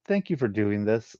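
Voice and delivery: ordinary delivery, nothing marked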